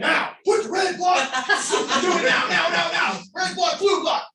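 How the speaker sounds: high shouted barking orders; drill sergeant voice. Shown as a demonstration. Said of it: "with a drill sergeant voice".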